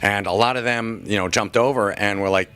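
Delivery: normal talking voice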